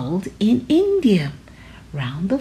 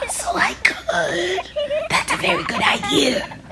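breathy laughing delight; chuckling tiger; 12 semitones higher than usual. Acted. Tiger chuckling at the idea of shoes on ears.

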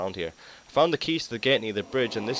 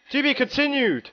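meme voice; 5 dB louder than usual. Pretend. Meme ending after the chase.

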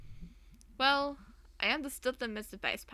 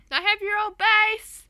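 much higher and louder, slow, thrilled; excited character shout; 11 semitones higher, 12 dB louder than usual. Acted. Player voicing Greg telling Mae he has her bass, excited shout. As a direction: blurt it out like an overexcited friend.

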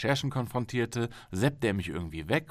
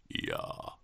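whispered yes; 6 dB quieter than usual. Acted. Golem's man answers quietly.

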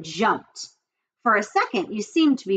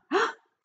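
gasp; 13 semitones higher, 4 dB quieter than usual. A narrator's reading. Wilbur sees danger.

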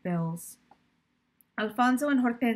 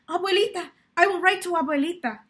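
higher, louder burst of hope; sudden hopeful idea; 8 semitones higher, 7 dB louder, faster than usual. Acted. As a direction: blurt it out as the answer hits her. Sudden idea to help Mama.

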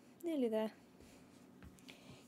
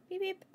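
high little car-horn imitation; two high pretend honks; playful horn beeps; 6 semitones higher than usual. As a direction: beep your way through, pitched up. Playing a horn while pushing through.

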